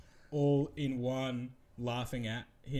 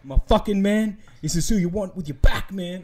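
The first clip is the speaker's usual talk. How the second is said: higher, louder pumped-up mate; imitating a hyped-up Kiwi mate; hyped Kiwi bro; 5 semitones higher, 8 dB louder than usual. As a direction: ham up the hype man.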